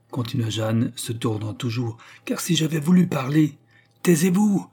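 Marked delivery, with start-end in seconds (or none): 2.3-3.6 defiant insistence. A narrator's reading. Jeanne persists while struggling. 4.0-4.7 high, loud roar for silence; roared order. A narrator's reading. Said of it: "crièrent en rugissant greffier".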